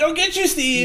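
high-pitched imitated vow of revenge; mock threat. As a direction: pitch it up, playing the vengeful hero. Imitates character threatening his foe.

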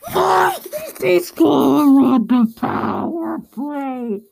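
slow, drawn-out wail of a despairing fan; hammed-up lament, crying out at your team; wailing sad fan. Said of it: "what it's like to be a sad fan".

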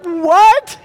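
loud, very high exclamation of disbelief; hammed-up stunned yelp; acted shock. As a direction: burst out like an appalled parent. Parents' shock, retold.

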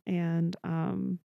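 ordinary talk

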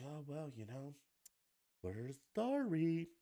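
high, drawn-out fake sorry; whiny mock-sorry voice; mocking apology voice. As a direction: sneer a limp insincere apology. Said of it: "everybody's still just like".

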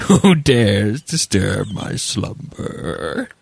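slow, booming cave guardian voice; Cave of Wonders voice. Pretend. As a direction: rumble it out like an ancient talking cave. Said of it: "the Cave of Wonders".

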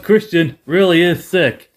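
slow, drawn-out heel delivery; milk the punchline slowly; hammed-up villain promo; slow mock promo. Wrestlers' heel promo, quoted.

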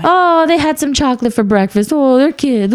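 high, indulgent mock in-law voice; imitating the in-laws. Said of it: "It's a free for all when the kids go".

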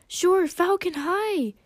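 high, loud, thrilled greeting; delighted scream. A narrator's reading. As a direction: shriek a happy hello. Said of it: "she screamed in delight".

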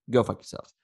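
usual speaking voice

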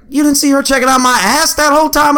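high and outraged, hammed-up; mock indignation. Telling his managers about the old lady, mock outrage, high. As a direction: play the offended victim.

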